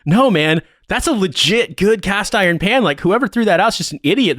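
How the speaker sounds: high, insistent retold argument; emphatic insistence. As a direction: argue earnestly for your find. Retold drunk insistence about pan.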